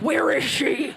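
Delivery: loud mock Batman demand; Batman growl. Jealous girlfriend as Batman, loud mock demand. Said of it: "turn into Batman".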